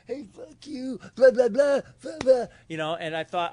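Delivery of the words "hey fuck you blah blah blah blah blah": high, loud mock yelling at dad; hammed-up furious outburst; angry teen. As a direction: shout it up high like a brat. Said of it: "I'm like".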